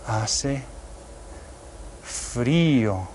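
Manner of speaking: "Hace" then "frío" split by a pause, slow and clear; slow dictation. Shown as a demonstration. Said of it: "don't forget to write your accent".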